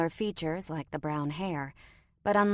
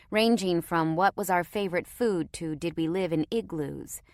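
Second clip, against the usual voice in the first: usual speaking voice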